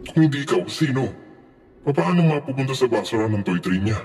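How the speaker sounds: high-pitched, agitated questioning; accusing. A narrator's reading. Wife interrogating husband about the toy train, agitated.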